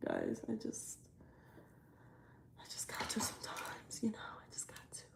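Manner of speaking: barely audible whisper. Real, not acted. Vlogger whispering to viewers after venting. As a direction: drop to a whisper, trailing away.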